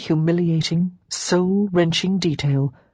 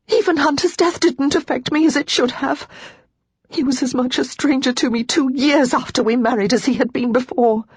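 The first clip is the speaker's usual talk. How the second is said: tearful; 7 semitones higher than usual. Acted. Lara sobbing confession.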